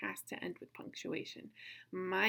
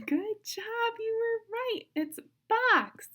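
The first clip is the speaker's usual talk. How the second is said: high-pitched delighted reveal; excited praise; 13 semitones higher, 7 dB louder, slower than usual. Real, not acted. Celebrating children's correct guess.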